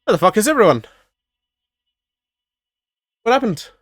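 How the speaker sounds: high-pitched, hammed-up confusion; mock bewilderment. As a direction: act baffled to find everyone gone, pitch way up. Two officers find the ship empty.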